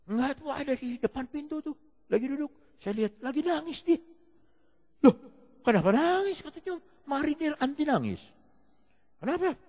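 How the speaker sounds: acted dialogue pitched well up; high storytelling voices. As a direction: voice the remorseful marine and pastor. (dialog diperankan dengan suara tinggi)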